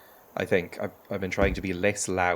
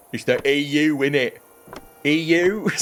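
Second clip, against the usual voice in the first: loud, higher mock-English dimwit; stupid person voice; 5 semitones higher, 7 dB louder than usual. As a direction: put on a daft English accent, loud and pitched up. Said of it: "have to do a stupid person voice, that I do an English accent".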